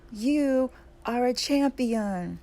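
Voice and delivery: high, slow echo from the mountain; echoed shout. A narrator's reading. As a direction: call it back in long, ringing words. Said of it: "The voice answers".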